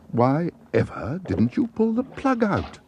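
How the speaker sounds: high, slowed, incredulous exclaim; a boy scolding the bear, high and slow; exasperated exclaim. A narrator's reading. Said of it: "exclaimed Jonathan".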